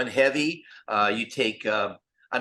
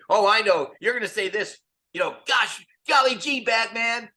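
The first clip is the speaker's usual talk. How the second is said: much higher, louder mock excited Robin; fans doing Robin; 9 semitones higher, 6 dB louder than usual. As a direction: shout it like an eager sidekick. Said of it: "they'd start doing our lines in front of us".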